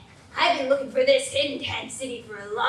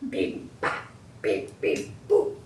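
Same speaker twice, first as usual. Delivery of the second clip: phone beeps; 4 dB quieter, slower than usual. Acted. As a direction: mouth dial tone beeps. Dialing an old phone.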